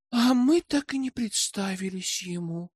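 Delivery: put-on high, mildly weary girlish voice; understated tiredness in a light voice; tired remark. A narrator's reading. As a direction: remark it with a touch of fatigue. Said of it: "устало заметила Аманда".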